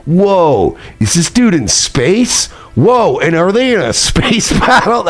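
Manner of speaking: high, wide-eyed mock amazement; imitating Melton's stunned whoa; mock amazed Melton. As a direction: play him gasping in wonder. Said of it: "he's like".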